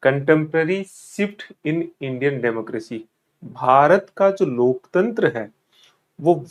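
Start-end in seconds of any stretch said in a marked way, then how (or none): 0.1-3.0 very slow, each word laid out for notes; slow dictation. Shown as a demonstration. Teacher reads the syllabus title slowly to aspirants. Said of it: "ध्यान से सुनना इसे".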